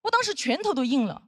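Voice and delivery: usual speaking voice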